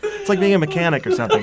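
real laugh carried into the words. Real, not acted. Joking along amid shared laughter.

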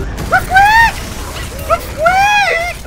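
very high, short rodent squeal; hamster squeak. Pretend.

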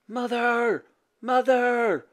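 high, drawn-out call. A narrator's reading. Boy hurrying into the castle, calling his mother. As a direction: call for someone in another room. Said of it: "called out Radius".

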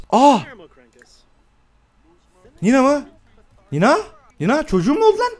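high, startled questions; shocked. Real, not acted. Gamer learns Nina gave birth, shocked. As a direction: caught off guard by the birth. Baby suddenly born.

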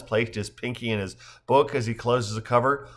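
usual speaking voice

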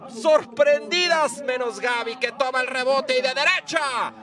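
slowed, high replay call; excited replay. Acted. Goal replay, drawn out. (repetición emocionada y alargada)

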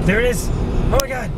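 high excited exclaim; thrilled shout of discovery. Real, not acted. Excited spotting the moon.

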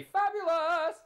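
high, loud, drawn-out exclamation; theatrical high-pitched cry of delight; exuberant catchphrase shout. Retold or imitated. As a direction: belt it out like a showy catchphrase. Acting out own catchphrase.

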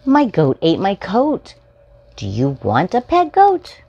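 ordinary delivery

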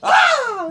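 loud, high guttural mock-German cry; guttural yell. Shown as a demonstration. Demonstrating how guttural American fake German is, guttural yell. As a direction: let out a harsh throaty yell. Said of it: "it's guttural".

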